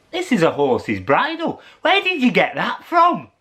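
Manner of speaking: surprised question. Acted. Jack questions Jill.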